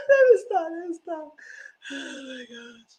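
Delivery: high voice fading to a spent sigh; breathless from laughing. Real, not acted. Recapper trying to stop herself, breathless with laughter. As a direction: gasp it out as the laughter dies down.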